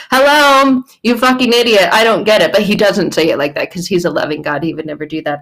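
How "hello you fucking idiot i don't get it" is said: louder, higher mock rant; exasperated teacher losing patience, half joking; mock exasperated Jesus. Jesus voiced scolding followers, mock exasperated and loud. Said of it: "he's like".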